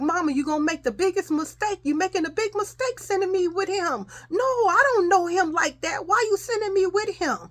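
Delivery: put-on high-pitched child arguing back; imitated pleading protest. Child telling mama she's making a mistake, pleading whine. Child argues against being sent away.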